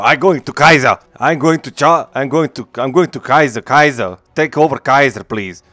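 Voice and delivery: mocking a game's German soldier who speaks broken English. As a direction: parody a German soldier fumbling his English orders. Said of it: "and not calling for".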